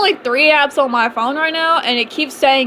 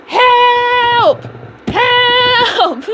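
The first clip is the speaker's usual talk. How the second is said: high, loud cry for help; trapped and yelling help; shouting for help; 11 semitones higher, 4 dB louder than usual. Retold or imitated. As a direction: yell it through the door. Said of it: "I'm banging on the door. I'm screaming".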